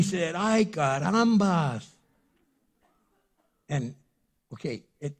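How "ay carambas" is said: drawn-out comic "Ay, carambas" sung out higher and louder; father seeing his daughter, hammed-up "Ay, carambas"; mock dismay. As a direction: ham up "Ay, carambas" slowly. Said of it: "it doesn't say he said".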